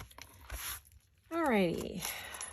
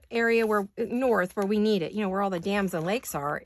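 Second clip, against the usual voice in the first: ordinary talk, nothing marked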